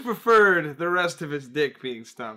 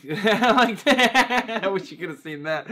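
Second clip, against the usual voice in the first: laughing loudly through the words; helpless laugh breaking into speech; real laughter; 6 semitones higher, 7 dB louder, slower than usual. Real, not acted. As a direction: crack up mid-sentence, higher and louder. Cracks up laughing while talking.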